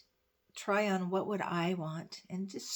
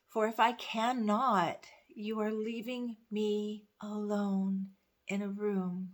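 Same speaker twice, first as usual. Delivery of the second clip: poem reading. Acted. Rereads the poem's ending.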